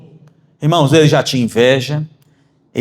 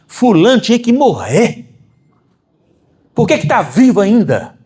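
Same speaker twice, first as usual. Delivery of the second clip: imitating a hateful gossip; 7 semitones higher than usual. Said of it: "Já ouviram alguém falar assim?".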